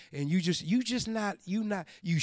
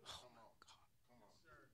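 slow breathless whispered groan; exasperated whisper; 9 semitones lower, 38 dB quieter, much slower than usual. Retold or imitated. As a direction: whisper it in disbelief, under your breath. Peter groans at Jesus's request.

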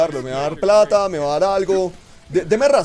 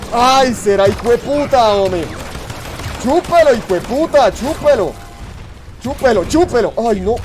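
loud, higher taunting shouts; gloating yells; 6 semitones higher, 6 dB louder than usual. Real, not acted. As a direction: rub it in the enemy's face, yelling. Taunting in a game fight.

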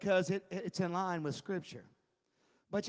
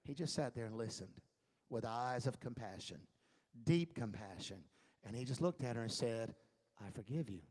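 tender forgiveness; 7 semitones lower, 8 dB quieter than usual. Acted.

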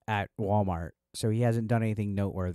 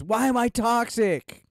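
quoting DSP; 10 semitones higher, 6 dB louder, slower than usual. Retold or imitated. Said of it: "My favorite quote is".

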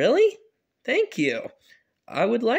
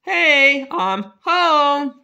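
calling out; 6 semitones higher, 6 dB louder, much slower than usual. Acted. Dad arrives calling out.

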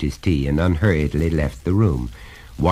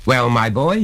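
higher, louder, hammering each point; stern father, pitched up and insistent; exasperated lecturing father; 8 semitones higher, 4 dB louder than usual. Acted. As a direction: lay down the law to a small son.

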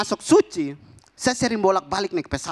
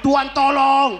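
louder, higher mock panic; panicked cry; 5 semitones higher, 6 dB louder, slower than usual. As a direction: cry out like a terrified passenger. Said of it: "Masnya panik". (teriakan panik pura-pura, lebih keras)

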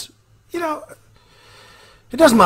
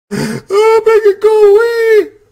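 put-on high, slow, whining child's plea; terrified kid; 11 dB louder, much slower than usual. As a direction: beg like a frightened child.

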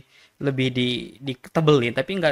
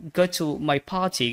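British accent; much slower than usual. Shown as a demonstration. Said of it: "kurang pede kalau yang British".

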